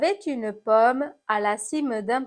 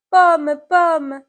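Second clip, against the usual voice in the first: loud, higher call to the apple; 6 semitones higher, 9 dB louder than usual. Acted. Someone calls to the fallen apple, calling out. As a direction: call out with alarm, pitch raised.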